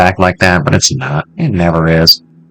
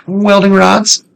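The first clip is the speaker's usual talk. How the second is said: excited; 11 semitones higher, slower than usual. Real, not acted. Excited find, high and slow.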